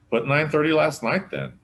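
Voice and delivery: high, loud, impatient snap. Acted. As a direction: brush it off impatiently. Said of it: "said Barney impatiently".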